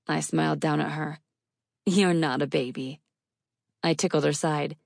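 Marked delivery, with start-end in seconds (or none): none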